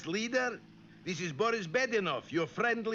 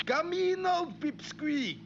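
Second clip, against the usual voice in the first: fake friendly; 7 semitones higher than usual. Luring moose, fake friendly.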